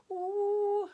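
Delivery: high, loud, drawn-out "ooh"; sudden high wavering "ooh"; unsettled "ooh". Shown as a demonstration. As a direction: let out a high "ooh" for jittery feelings. Voicing an unsettled body feeling.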